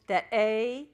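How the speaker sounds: usual speaking voice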